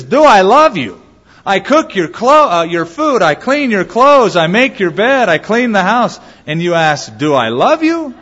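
the wife snaps back at her husband; exasperated wife. Retold or imitated. Exasperated wife lists her chores.

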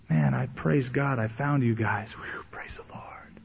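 whispered, slow and breathy with relief; hushed pious whisper. Retold or imitated. Con man whispering thanks to church members, hushed. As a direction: whisper it like a grateful stray.

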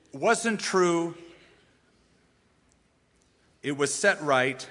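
ordinary talk, nothing marked

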